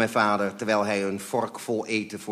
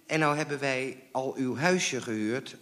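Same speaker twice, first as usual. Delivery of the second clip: higher, dejected plea; very despondent; 4 semitones higher than usual. A narrator's reading. Mother telling caller about the rental, despondent. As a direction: carry on with the heart gone out of it. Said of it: "zeer moedeloos".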